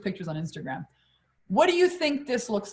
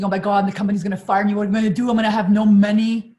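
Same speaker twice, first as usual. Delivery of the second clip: panicked thoughts; 8 dB louder, faster than usual. Retold or imitated. Panicking while driving away.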